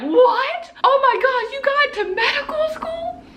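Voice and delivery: very high-pitched, thrilled disbelief; astonished friend. Retold or imitated. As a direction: imitate a friend stunned by wonderful news. Friend's stunned reaction, retold.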